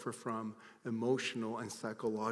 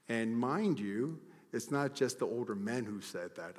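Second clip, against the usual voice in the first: usual speaking voice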